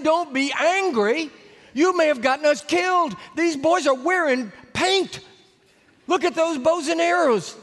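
high, loud, exasperated rebuke; angry scolding. Retold or imitated. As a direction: scold him, alarmed and angry. Preacher scolds Carlos.

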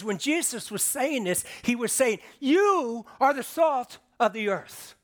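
"You" sözcüğünü yüksek ve yüksek sesle vurgula, yavaşça; emphatic you. Shown as a demonstration. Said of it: "there for emphatic purposes".

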